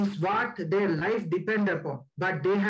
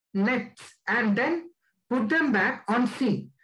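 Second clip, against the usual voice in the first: ordinary delivery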